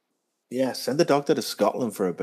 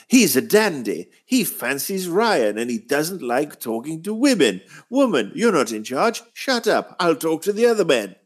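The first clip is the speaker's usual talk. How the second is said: higher, lightly hammed pompous king; mock King James; 7 semitones higher than usual. Critic imitating the episode's dismissive king, lightly higher. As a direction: sneer it like a vain dandy king. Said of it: "mocking the King James character".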